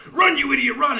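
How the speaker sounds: high, loud yell aped from the crowd; copycat yelling. Acted. Jacques copying the crowd at a baseball game, loud high yell. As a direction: belt it out like an eager new fan. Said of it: "So he starts yelling".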